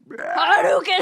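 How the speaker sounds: high, loud battle cry; game attack shout. Pretend. Pretending to throw a video game fireball, loud shout. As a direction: yell it like launching a fireball.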